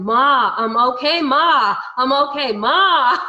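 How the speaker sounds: high, loud yell for mom; son's insistent shouting, retold with a laugh at the end; imitated yelling. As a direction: yell it as if through a glass wall. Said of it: "but he's yelling".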